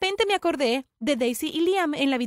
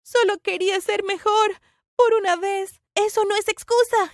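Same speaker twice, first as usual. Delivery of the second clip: high, raised, emphatic voice; heated quarrel; 7 semitones higher, 5 dB louder than usual. Acted. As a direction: argue back with feeling. Defensa y réplica en la pelea. (discusión exaltada, voz aguda y fuerte)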